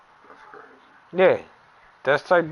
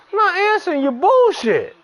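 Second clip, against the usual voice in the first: high, louder irritated retort; fed-up rant at a friend; exasperated outburst; 4 dB louder than usual. Real, not acted. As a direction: snap back in frustration. Ranting about ignored friend.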